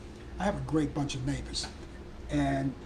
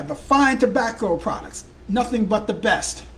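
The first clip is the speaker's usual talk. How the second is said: louder, higher pitchman voice; mock ad plug; 4 semitones higher, 6 dB louder than usual. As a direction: sell it like a radio ad. Mock plug for tobacco products.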